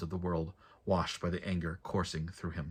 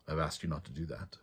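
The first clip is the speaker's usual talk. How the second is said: much softer, gentle rebuke; softly; 4 semitones lower, 7 dB quieter than usual. A narrator's reading. Joven gently scolds Leif on the road, soft and low. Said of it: "Joven said softly".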